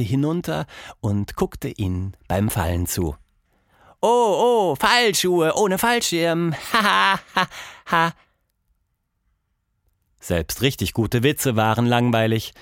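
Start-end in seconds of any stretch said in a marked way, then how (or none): 4.0-8.1 put-on high, slow child's voice chuckling at her own pun; laughing at own joke. Lonely Tilda laughing at her own shoe joke, high and giggly. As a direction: giggle through the silly joke.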